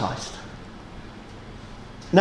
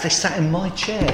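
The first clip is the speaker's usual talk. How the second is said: usual speaking voice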